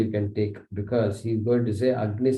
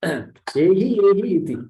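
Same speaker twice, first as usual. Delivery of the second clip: higher and louder, calling out come here; eager welcoming call; 6 semitones higher, 4 dB louder than usual. Retold or imitated. Adityas welcoming the arriving soul, called out eagerly. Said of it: "They will call you".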